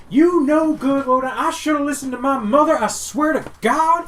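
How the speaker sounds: high, loud ranting wife; high, loud scolding wife yelling at her husband; furious wife. Acted. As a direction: berate the husband at the top of your voice. GM voices wife berating husband.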